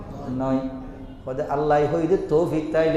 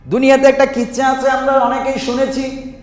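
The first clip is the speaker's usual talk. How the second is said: fiery preaching; 11 semitones higher, 10 dB louder than usual. Acted. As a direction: preach it out to the crowd. Opens the sermon at full force.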